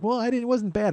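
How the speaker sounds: high voice mimicking polite denial; high-pitched imitation. Friend answering the apology, high-pitched and placating.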